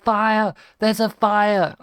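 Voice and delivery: high, loud cry of fire; retold alarm shout. Kids bursting into the shed to dad, mock alarmed shout. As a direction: yell a warning as if bursting in.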